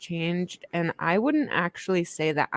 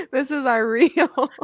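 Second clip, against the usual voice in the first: normal talking voice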